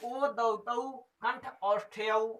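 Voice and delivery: slow chanted Sanskrit rule; sutra recitation. Shown as a demonstration. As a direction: intone the rule slowly. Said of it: "ओ को कहाँ से बोलना है?". (धीमा सूत्र पाठ)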